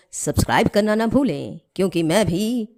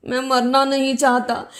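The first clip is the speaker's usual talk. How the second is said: lightly acted pleading cry, higher than the title; mild acted cry; 4 semitones higher than usual. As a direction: plead mildly like a drowning man. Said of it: "चिल्ला रहा था". (हल्की नाटकीय गुहार)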